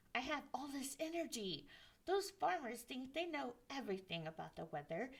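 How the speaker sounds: put-on fast, restless boyish chatter; eager rush. Young Jack bragging to his mother, fast and eager. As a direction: tumble it out, too excited to wait.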